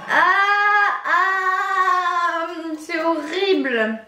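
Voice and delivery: pained high cry, mouth on fire; wailing from the heat of the sauce; mouth burning from spicy food. Real, not acted. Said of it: "j'ai la bouche en feu".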